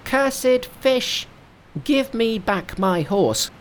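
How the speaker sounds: very high monkey cry, slow; Monkey calling loudly. Acted. Monkey calls the dragon from the bank, loud high cry. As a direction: call down to the river dragon. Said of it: "called in a loud voice".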